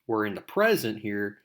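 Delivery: normal talking voice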